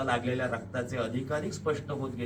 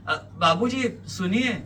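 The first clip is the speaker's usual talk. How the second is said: raised, higher-voiced call from above; 6 semitones higher, 6 dB louder than usual. A narrator's reading. Woman upstairs to departing man, calling out. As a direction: call after someone to stop them. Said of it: "वरून हाक येते".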